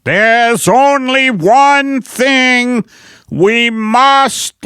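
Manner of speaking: slow, drawn-out orator; FDR speech parody. Acted. Comedian parodies a presidential address on fear, slow oratory. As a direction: stretch each word like a grand radio address.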